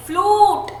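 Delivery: फ्लूट with a drawn-out "oo"; फ्लूट held long in correction. Shown as a demonstration. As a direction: stretch फ्लूट. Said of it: "लंबा खींच रहे हैं".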